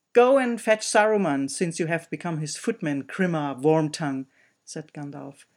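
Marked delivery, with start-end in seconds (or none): 0.1-4.3 loud, scornful command; stern rebuke. Acted. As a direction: put the servant in his place. Gandalf rebukes servant.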